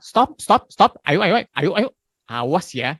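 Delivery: loud, high stop stop stop; yelling parent. Retold or imitated. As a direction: bark orders at a child. (orang tua membentak anak dengan keras)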